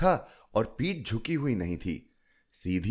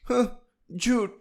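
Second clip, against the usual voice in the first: put-on high child's voice breaking off a sob; a little girl scoffing between sniffles, high; sniffling child; 14 semitones higher, 4 dB louder than usual. A narrator's reading. Chilki calls her grandmother a liar, high sniffly child. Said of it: "वह सिसकी तोड़ती बोली".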